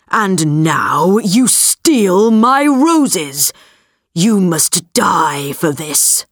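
low, slow distorted beast roar; deep distorted monster roar. A narrator's reading. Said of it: "roared the beast".

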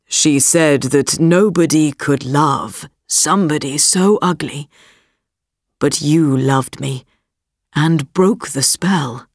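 put-on low, steady young prince; a prince explaining, pitched low. A narrator's reading. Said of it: "said the prince".

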